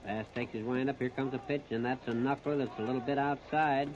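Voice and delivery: ordinary delivery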